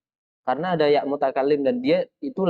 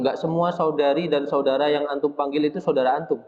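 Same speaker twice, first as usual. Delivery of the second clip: normal talking voice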